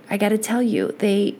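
usual speaking voice

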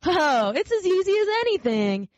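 high, bright boastful rabbit voice; cheerful wild rabbit. Acted. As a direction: show off how easy it is.

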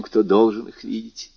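usual speaking voice

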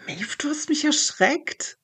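breathy startled whisper, high. Acted. Girl startled by her friend at the window, high whisper. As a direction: gasp it out, still shaken from the fright. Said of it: "Erschrocken schaue ich zum Fenster".

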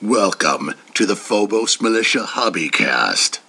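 very low, heavy hero voice; deep Batman voice. Shown as a demonstration. Perfect take of the podcast intro as Batman. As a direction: sink deep into the words. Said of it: "deep Batman voice".

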